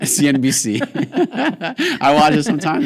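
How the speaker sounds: loud, high, laughing explanation; real laughter breaking into the words. Real, not acted. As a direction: defend the silly voice through laughter. Cracking up after doing the voice.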